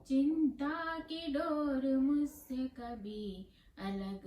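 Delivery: melodic sing-song verse, slowly drawn-out line endings; poem recitation. Acted. Poet reciting her own verse to listeners, lilting recitation. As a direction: chant the poem tunefully, stretching the vowels.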